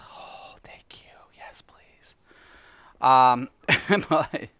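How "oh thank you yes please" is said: breathy whisper of thanks; just whisper the thank-you. Real, not acted. Streamer whispers thanks to a chat viewer.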